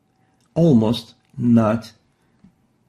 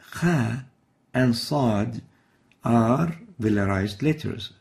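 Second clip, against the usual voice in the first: ordinary delivery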